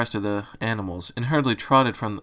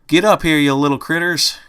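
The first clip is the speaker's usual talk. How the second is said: a country southern pony calling the critters, louder; southern accent calling out; 5 semitones higher, 6 dB louder than usual. A narrator's reading. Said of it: "came a familiar southern accent".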